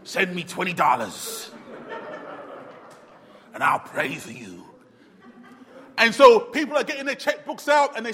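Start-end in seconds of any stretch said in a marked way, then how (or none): 0.0-4.6 low, mocking American TV-preacher pitch; mock American accent. Preacher parodying TV evangelists in an American accent. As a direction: lampoon the American televangelist. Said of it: "it's always an American accent".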